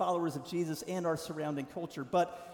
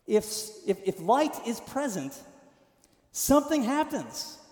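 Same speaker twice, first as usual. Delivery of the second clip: building fervor; 5 semitones higher than usual. Real, not acted. Building emphasis on point.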